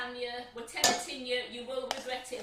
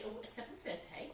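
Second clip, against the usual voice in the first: posh voice; 3 semitones lower, 9 dB quieter than usual. Pretend. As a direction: say it like a posh lady. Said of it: "Put your posh voice on there".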